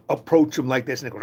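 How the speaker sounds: ordinary talk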